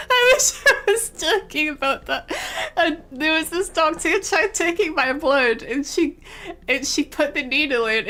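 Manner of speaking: half laughing, half crying; laughing through it. Real, not acted. Telling viewers about the botched blood test, laughing helplessly.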